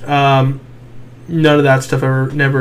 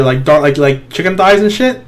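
normal talking voice

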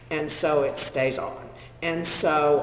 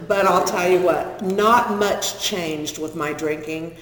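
ordinary talk, nothing marked

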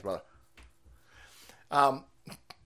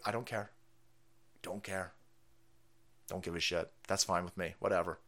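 dismissive; 10 semitones lower, 9 dB quieter than usual. Real, not acted. Dismissive aside after insult.

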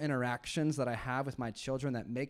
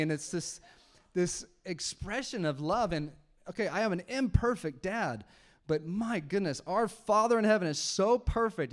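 louder and higher, pressing every line; full-force preaching; 5 semitones higher, 5 dB louder than usual. Acted. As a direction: preach it to the back row. Preaches with rising intensity.

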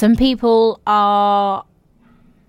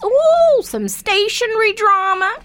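high, bright gossipy ooh; excited; 12 semitones higher, 3 dB louder, slower than usual. Real, not acted. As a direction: relish the juicy drama. Delighted reaction to story.

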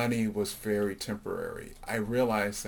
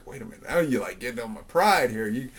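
retold indignation, building; indignant protest; 3 semitones higher, 5 dB louder than usual. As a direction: push back, playing up wounded pride. Retold protest to teasing mom.

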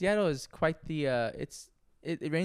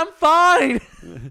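high, loud exasperated yell; loud shout; 12 semitones higher, 12 dB louder than usual. Retold or imitated. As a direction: shout it out, much higher and louder. Shouting reply to worried owner.

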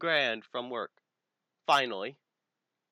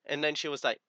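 normal talking voice

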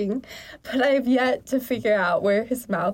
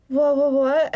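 stammered, higher and louder; shaking voice; 5 semitones higher, 5 dB louder than usual. A narrator's reading. Narrator acts out the stunned reply to the alien, stammering. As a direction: stammer it out in shock, mildly shaky. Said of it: "my voice shaking".

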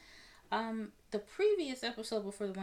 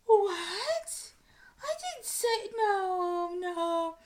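much higher and louder, squealing in denial; Erica, mock outraged; 11 semitones higher, 10 dB louder, much slower than usual. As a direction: play the drunk woman caught out and acting innocent. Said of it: "And Erica's just like".

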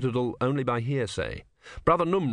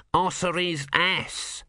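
prompt; 7 semitones higher, much slower than usual. A narrator's reading. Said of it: "said Brother Numrod promptly".

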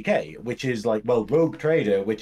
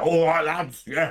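lightly done Cockney hard man greeting; a quick Cockney bloke voice for an orc; 4 semitones higher than usual. Retold or imitated. Warhammer orcs greeting their mates, light Cockney. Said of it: "like, sort of Cockney".

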